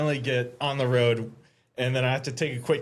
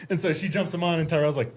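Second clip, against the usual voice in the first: normal talking voice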